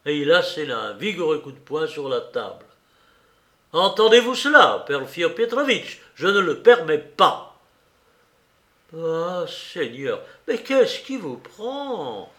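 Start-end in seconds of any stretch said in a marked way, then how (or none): 3.7-7.6 loud, high, indignant shout; angry outburst. A narrator's reading. Suspect shouts at the investigator, furious and loud. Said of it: "atteignit le diapason le plus élevé". 9.0-12.3 slow, high, drawn-out dismay; alarmed exclamation. A narrator's reading. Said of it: "en apparence fort inquiet".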